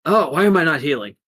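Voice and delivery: acted panicky question; alarmed hero. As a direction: cry out in quick alarm. Hero discovers he isn't healing.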